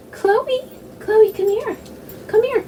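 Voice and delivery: high sing-song call for the pet; high calling to dog. Real, not acted. Calling her dog Chloe over, high coaxing voice.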